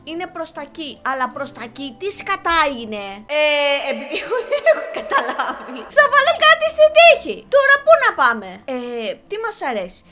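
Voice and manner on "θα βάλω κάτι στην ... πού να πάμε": high, loud, giddy exclamation; giving up with a loud squeal; flustered outburst. Real, not acted. Picks at random, confused.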